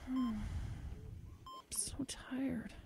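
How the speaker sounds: breathy, fading whisper; drifting off, barely voicing the words; exhausted whisper. Injured woman whispering to the ranger, worn out.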